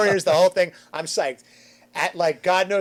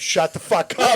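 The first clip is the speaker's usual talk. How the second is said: loud acted outburst; exasperated retold yell; angry snap; 3 semitones higher, 8 dB louder, slower than usual. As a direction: imitate yourself lashing out, pissed off. Acting his anger at Julian.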